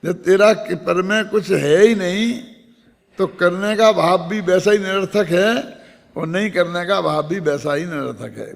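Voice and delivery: usual speaking voice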